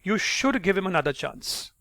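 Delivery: "should" lengthened and leaned on; emphatic "should", urging the point; stress on should. Shown as a demonstration. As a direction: punch the word "should". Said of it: "stress on the word can and should".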